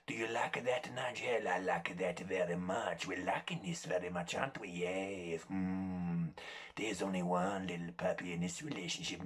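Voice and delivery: put-on low, soft, ruthless little dog with a Spanish accent; hushed, silky Spanish-accented pet voice; Antonio Banderas as Puss in Boots. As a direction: purr it low and quiet, quietly domineering. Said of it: "Antonio Banderas as Puss in Boots".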